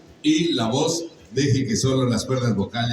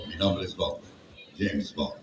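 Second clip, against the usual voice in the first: lower, softer, suave spy voice; James Bond line; 6 semitones lower, 8 dB quieter than usual. Shown as a demonstration. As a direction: purr it low like Bond. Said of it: "nada más como digo".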